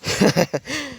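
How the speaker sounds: high, strange mechanical laugh; giddy robot villain laughing at the heroes; robotic villain cackle. A narrator's reading. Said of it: "as it laughed and laughed".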